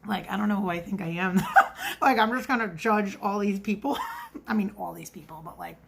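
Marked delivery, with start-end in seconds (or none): 1.4-2.0 short high giggle; embarrassed laugh at her own judging. Real, not acted. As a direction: laugh at yourself. Laughs at herself.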